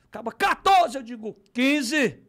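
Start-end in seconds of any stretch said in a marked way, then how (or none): 0.3-1.0 loud, high auction call; shouted counter-bid. Retold or imitated. Rival bidder tops him at auction, shouting. 1.5-2.2 loud, high auction yell; shouted bid. Retold or imitated. Eager bidder calls first at the auction, shouting. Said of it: "o primeiro logo a gritar".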